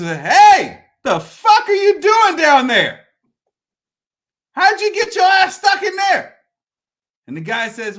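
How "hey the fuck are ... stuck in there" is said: loud, high holler down a hole; Linda. Retold or imitated. Lady at the edge hollering down to stuck man, brash shout. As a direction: shout down bluntly, incredulous.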